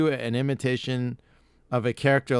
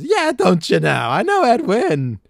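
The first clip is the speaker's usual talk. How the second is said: put-on much higher, louder silly old comedian; Ed Wynn impression; 11 semitones higher, 9 dB louder than usual. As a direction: warble like a giddy forties comic. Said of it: "one of them he does is Ed Wynn".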